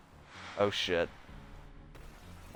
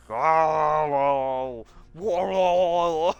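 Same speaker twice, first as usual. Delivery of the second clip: real amused laugh; 4 semitones higher, 3 dB louder than usual. Real, not acted. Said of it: "fun making the zombie noises".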